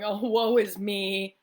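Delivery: slow, hammed-up self-pity; mock woe. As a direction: drag it out in mock misery, not real tears. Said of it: "crying and going".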